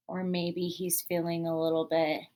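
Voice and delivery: ordinary talk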